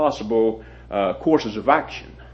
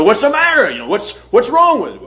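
retold urgent shout, pitched much higher and louder; urgent call; 8 semitones higher, 5 dB louder than usual. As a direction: call out to the bystander in alarm. Calling out at the crash scene.

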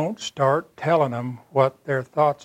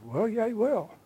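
much higher, falling at the end; retold concession; 9 semitones higher than usual. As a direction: imitate the man conceding in a lightly raised voice. Dying man concedes after a pause.

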